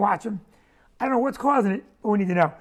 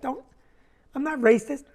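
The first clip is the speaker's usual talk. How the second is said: normal talking voice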